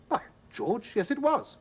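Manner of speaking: high, startled confirmation; surprised agreement. A narrator's reading. Husband amazed his wife guessed his dream, high. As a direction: blurt it out, pitched well up.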